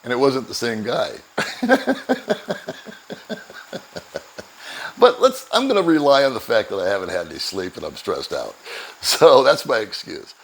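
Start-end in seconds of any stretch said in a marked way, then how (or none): 1.2-4.4 a real high breathy laugh at his own mistake. Real, not acted. Telling travel story, laughs at himself with a high breathy laugh.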